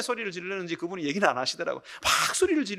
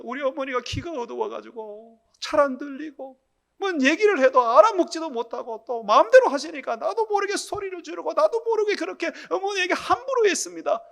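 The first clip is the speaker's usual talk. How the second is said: high, wailing imitation of a confession; tearful repentance; 7 semitones higher, 5 dB louder than usual. Deacon on his knees confessing to God, tearful repentance. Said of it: "엉엉 울고 회개를 했대요".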